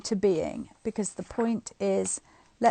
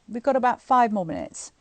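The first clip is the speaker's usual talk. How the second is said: usual speaking voice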